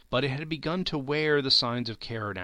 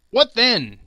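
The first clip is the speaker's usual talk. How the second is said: sharp raised what then; louder, higher retort; 10 semitones higher, 11 dB louder than usual. A narrator's reading. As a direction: snap back at her. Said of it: "he retorted".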